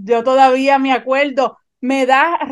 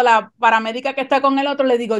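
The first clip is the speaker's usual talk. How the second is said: ordinary talk, nothing marked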